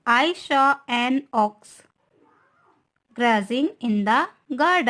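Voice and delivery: reads the sentence very slowly, word by word; slow dictation. Shown as a demonstration. Teacher dictating an example sentence to students, very slow. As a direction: spell it out slowly for the class. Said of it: "teacher reads example sentence slowly".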